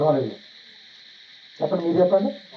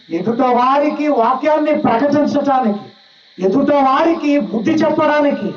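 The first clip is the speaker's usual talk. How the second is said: fervent preaching; 7 semitones higher than usual. Acted. Preacher urging the congregation to know God's will, fervent preaching. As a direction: plead with the church to change. Preaching plea to understand God.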